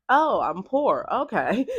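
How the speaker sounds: mock dismay. Mock reaction to rich kid.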